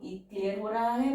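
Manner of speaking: ordinary talk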